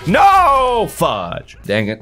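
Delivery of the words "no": high, loud cry of dismay; anguished shout. Real, not acted. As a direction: yell as you die. Dying in game.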